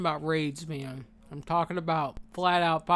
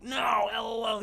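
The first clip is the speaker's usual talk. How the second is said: dismissive mock brush-off, higher; 6 semitones higher than usual. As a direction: scoff it off in a silly voice. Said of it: "you're over here like".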